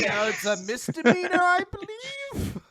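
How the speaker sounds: squeaky high, hesitant self-justification; mock defensive creep. Creep claiming it is not illegal, hammed-up uncertainty. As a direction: climb into a very high, unsure question.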